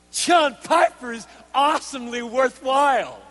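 hammed-up heavenly announcement; mock angelic proclamation. Preacher parodying what angels don't say, mock proclamation. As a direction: declaim it as absurd praise. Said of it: "So what do the angels say?".

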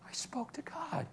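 whispered, hushed in wonder; soft whisper of disbelief at answered prayer; awed whisper. Retold or imitated. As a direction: breathe it like a secret prayer. Awed inner thought, hushed.

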